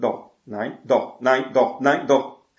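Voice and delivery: back-and-forth that builds from normal to loud; escalating quarrel. Retold or imitated. Parent and child arguing.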